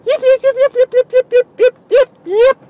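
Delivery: very high, loud yapping yips; brother's yip yip. Shown as a demonstration. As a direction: yip like an excited little dog. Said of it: "start feeling good if you're going".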